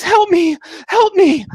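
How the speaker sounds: high, loud scream for help; panicked woman. Acted. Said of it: "and she says".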